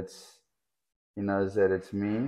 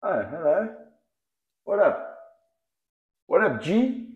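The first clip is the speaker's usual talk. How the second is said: higher, bouncy hello to a pet; playful pet greeting; 9 semitones higher than usual. Real, not acted. Owner greets his snake in a higher, playful voice. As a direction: greet him like a buddy, pitch up.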